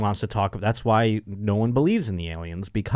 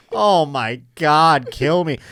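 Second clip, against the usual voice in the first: weary groan; 4 semitones higher, 4 dB louder, much slower than usual. Retold or imitated. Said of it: "be like".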